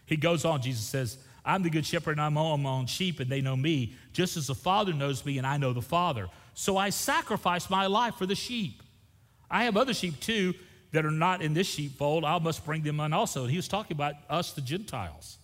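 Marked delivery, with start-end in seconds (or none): 1.5-13.4 raised, loud preacher's reading voice; preacher reading John aloud to the church; scripture reading. Acted. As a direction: proclaim the Bible passage to the congregation. Reads scripture passage aloud.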